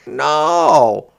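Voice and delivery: put-on loud, indignant boy's no; indignant roar. A narrator's reading. Brother refuses his sister's trade with a loud indignant no. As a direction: refuse her flatly and loudly. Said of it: "roared her brother indignantly".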